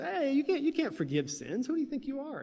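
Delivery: high-pitched mock indignation; indignant challenge. Pharisees challenge Jesus for forgiving sins, mock indignant. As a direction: act out an objector, pitch leaping up.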